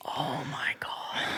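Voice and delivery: breathless whispered dread. Real, not acted. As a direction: drop to a whisper. Dreading the Boost Juice customers.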